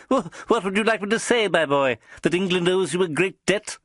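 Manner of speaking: high, teasing spymaster voice; amused little boss. A narrator's reading. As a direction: tease him from up high.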